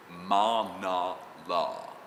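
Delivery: very slow, separated syllables; elocution drill. Retold or imitated. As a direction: intone each syllable like a voice coach.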